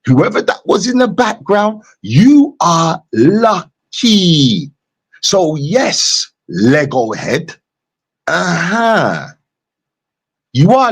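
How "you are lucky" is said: "you are lucky" stretched out very slowly; emphatic. Real, not acted. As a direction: draw out each word of "you are lucky". Hammering home opponent's narrow escape.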